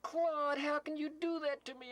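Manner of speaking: ordinary talk, nothing marked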